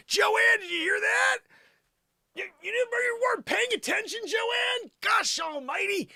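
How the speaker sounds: high-pitched exasperated outburst; exasperated rant. Acted. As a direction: scold her in a high, strained voice. Comic rant at absent Joanne.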